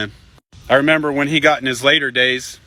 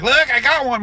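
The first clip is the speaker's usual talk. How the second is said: put-on proud kid; 10 semitones higher than usual. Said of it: "holding it up like".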